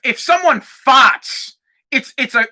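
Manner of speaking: ordinary talk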